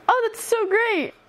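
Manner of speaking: high, slow forced cheer; awkward reply. Retold or imitated. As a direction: fake cheer to hide discomfort. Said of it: "I just went".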